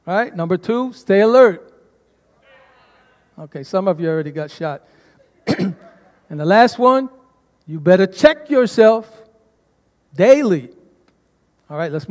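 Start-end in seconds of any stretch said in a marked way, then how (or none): 0.9-1.7 loud, punchy command; with conviction. Acted. Pastor commanding the church like soldiers, loud. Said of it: "say it with conviction". 7.7-10.7 with attitude. Acted. Pastor warns the congregation, attitude. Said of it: "say that with attitude".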